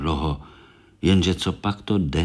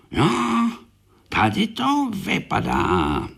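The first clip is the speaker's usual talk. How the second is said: slow, higher "tady to vypadá" in beetle voice; Korálek's dismay; 12 semitones higher, slower than usual. Acted. Korálek dismayed at the messy room, slow high voice. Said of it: "říká si Korálek".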